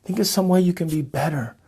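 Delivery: closing blessing. Acted. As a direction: bless them gently, like a prayer.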